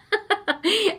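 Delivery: short high-pitched giggle; amused laugh. Real, not acted. Presenter amused at needing another birthday set, laughing. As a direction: break into a quick real laugh. Amused by twelve-years-older remark.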